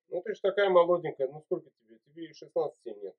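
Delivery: ordinary delivery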